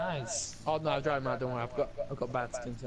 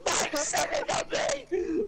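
high, louder squeal through real laughter; laughing hard; 3 dB louder, much slower than usual. Real, not acted. Friend blaming Mike for the joke, laughing hard. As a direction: blurt it out while cracking up. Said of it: "That fucking laugh is stupid".

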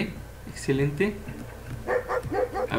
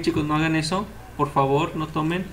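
ordinary talk

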